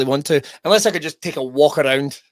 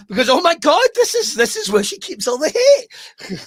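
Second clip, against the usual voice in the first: hammed-up appalled squeal, slowing down; mock horror; 13 semitones higher than usual. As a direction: exclaim it high, in pretend horror. Mock horror at sister's hidden hate.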